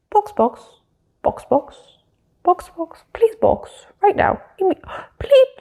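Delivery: high, hushed, urgent squeaks; hammed-up high-pitched pit-wall panic; mock frantic radio call.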